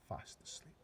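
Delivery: soft whispered ending. A narrator's reading. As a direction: hush it like sleeping giants. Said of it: "narrator hushes at end".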